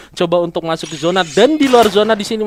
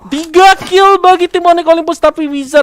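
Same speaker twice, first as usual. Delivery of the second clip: excited; 9 semitones higher, 5 dB louder than usual. Real, not acted. Caster calls triple kill.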